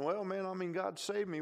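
a hint of a fumbling new believer; lightly voiced convert. Preacher voicing the convert answering his friend, lightly acted.

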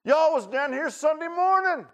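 high, loud scoffing voice of the crowd; mocking townsfolk. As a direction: sneer at the fanatics from the road. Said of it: "He's like".

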